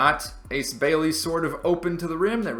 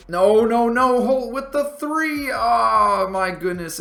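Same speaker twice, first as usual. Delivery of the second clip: much higher and louder, yelling at the screen; real dismayed shout; 9 semitones higher, 5 dB louder, slower than usual. Real, not acted. Gamer yelling as the rival star hits a three, dismayed shout. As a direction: cry out as the three drops.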